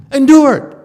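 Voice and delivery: loud, firm, insistent; a stern teacher barking an order; forceful command. Real, not acted. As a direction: drive the order home hard. Teacher hammering a hard lesson.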